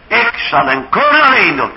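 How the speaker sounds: repeated vow. Acted. Ahithophel repeating his vow to Absalom, loud. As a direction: hammer the threat home.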